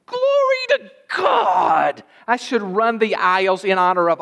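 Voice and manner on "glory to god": high, slow shout of glory; praise shout. Real, not acted. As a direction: lift it up high and cry out. Preacher erupts in praise.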